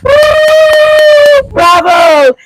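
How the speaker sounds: loud high whoop of joy; cheer. Real, not acted. Cheering the viewers with a loud whoop. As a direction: whoop like you're celebrating.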